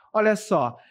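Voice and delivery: usual speaking voice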